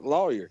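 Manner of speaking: "Lawyer" said as a Southerner says it; demonstrated pronunciation. Shown as a demonstration. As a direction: show how "Lawyer" sounds in Southern speech. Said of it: "that's how you say it".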